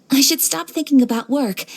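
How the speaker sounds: ordinary delivery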